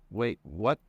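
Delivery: incredulous, rising question. A narrator's reading. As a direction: punch out a disbelieving question. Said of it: "glared at me incredulously".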